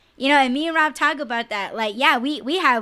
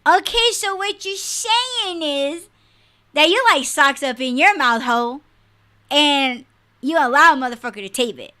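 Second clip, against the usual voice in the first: sarcastic; 3 semitones higher, 5 dB louder than usual. Real, not acted. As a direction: drag out the okay mockingly. Sarcastic reply to commenter.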